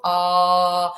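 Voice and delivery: drawn-out "a", tongue kept down; vowel demo. Shown as a demonstration. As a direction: hold an open "a" for the class. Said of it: "que tengo la lengua abajo".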